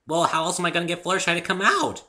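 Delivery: high, loud bubbly pony; bouncy, can't-you-see-it brightness; eager pony stating the obvious; chipper Pinkie voice. A narrator's reading.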